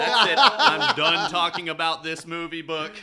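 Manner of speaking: high, loud laughing give-up. Real, not acted. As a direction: laugh it off as you quit. Giving up on the topic, laughing.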